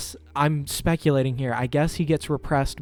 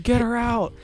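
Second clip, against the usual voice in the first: high-pitched urgent cry; raised-pitch mock alarm; mock panic; 10 semitones higher than usual. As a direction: imitate a panicked crowd member. Imitating everyone panicking at her.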